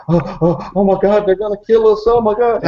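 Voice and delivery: high, gasping mock panic; hyperventilating panic. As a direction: imitate a terrified man gulping for air. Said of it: "he starts hyperventilating".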